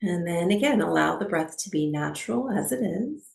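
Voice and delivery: calm, unhurried meditation guide with long pauses; guided meditation. Acted. Teacher settling a class into breathwork, calm guided meditation. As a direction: ease them into stillness, phrase by phrase.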